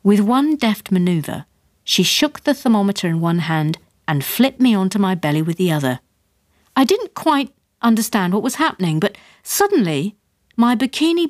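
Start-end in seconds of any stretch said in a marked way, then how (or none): none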